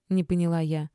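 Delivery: usual speaking voice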